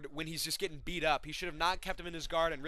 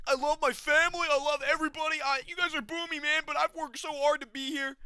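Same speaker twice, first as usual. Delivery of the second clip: much higher, louder, whiny mock voice; mocking impression; 11 semitones higher, 6 dB louder than usual. Mocking the loser's emotional speech, high whiny voice. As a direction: parody the post-fight speech, squeaky and over the top. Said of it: "went on this fucking tirade".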